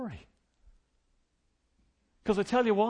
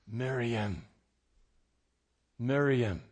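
tender calling; 7 semitones lower, much slower than usual. Acted. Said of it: "He spoke her name".

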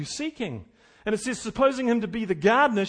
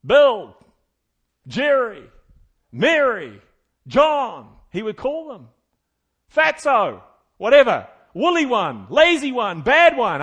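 loud shepherd's call; shepherd hollering names at sunset; 4 semitones higher, 12 dB louder, slower than usual. Acted. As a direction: call each sheep across the field. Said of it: "he would call his sheep by name".

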